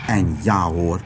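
plain reading voice

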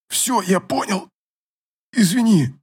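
hoarse pained wheeze, winded; wheezing. A narrator's reading. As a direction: gasp it out after a punch. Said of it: "прохрипел Олег".

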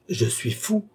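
high-pitched, indignant exclamation; jerky cry. A narrator's reading. Philippe, called mad by Balsamo, cries out in a jerky voice. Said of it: "s'écria Philippe d'une voix saccadée".

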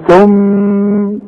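ثم held with a nasal hum, then stopped; ghunna on stop. Shown as a demonstration. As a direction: pause on ثم with a two-beat hum. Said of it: "طب هقف".

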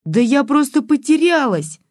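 put-on high-pitched girlish outcry; desperate cry. A narrator's reading. Girl defending herself to granny, desperate cry. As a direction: cry out in despair, voice way up. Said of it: "с отчаянием в голосе выкрикнула".

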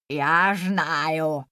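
low, teeth kept shut; through clenched teeth. A narrator's reading. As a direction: speak without opening the teeth. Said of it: "не размыкая зубов".

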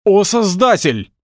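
громкий протяжный высокий возглас; взывай громко, растягивая слова; крик толпы. A narrator's reading. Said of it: "поднялся шум". (протяжный вопль толпы)

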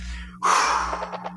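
empty the lungs in a long sigh; soft, voiceless breath all the way out; long exhale. Shown as a demonstration. Teacher showing how to let all the air out, long exhale. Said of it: "release all the air in your lungs".

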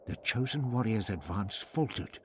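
plain reading voice

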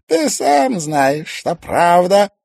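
high, slow merciless villain; ruthless villain. Acted. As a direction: crush him without pity. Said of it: "безжалостно ответил мастер игрушек".

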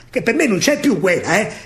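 usual speaking voice

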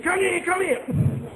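high drawn-out come-in call; high, slow beckoning. Retold or imitated. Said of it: "I tell your sister".

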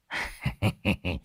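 mocking chuckle. Exorcist laughing at the beaten ghost.

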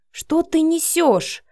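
slow, high-pitched indignant hiss; angry hiss. A narrator's reading. Matvey dragging Vika into the flat, hissing angrily. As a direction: hiss it in drawn-out disbelief. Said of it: "прошипел Матвей".

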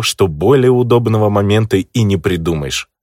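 plain reading voice

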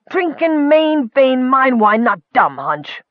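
high, slow, insistent comeback; indignant retort. A narrator's reading. Leader defending his drinking plan, indignant. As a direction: argue back as an offended little ruler.

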